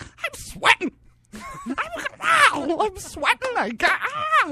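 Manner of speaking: high, loud, laughing excitement; giddy excitement. Retold or imitated. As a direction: squeal with discount-store joy. Excitement at the dock sale.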